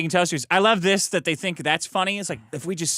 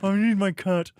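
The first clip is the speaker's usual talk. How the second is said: Milo impression; 3 semitones higher, 4 dB louder than usual. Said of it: "another impression of Milo Yiannopoulos".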